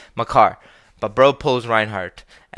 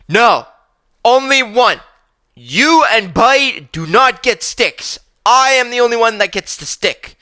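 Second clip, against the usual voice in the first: put-on high, slow, bossy kid voice, louder; bossy little kid; 10 semitones higher, 5 dB louder than usual. Pranav from the back seat telling the others they get no sticks, high bossy kid voice. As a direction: lay down the law like a spoiled kid.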